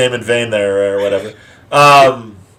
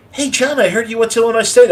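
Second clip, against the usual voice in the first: put-on young coworker voice; 9 semitones higher than usual. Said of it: "they'd be like".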